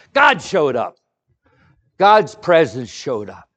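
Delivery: God showed up, proclaimed loudly; loud triumphant proclamation; preaching at full force. Acted. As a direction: declare it to the whole church. Preaching climax.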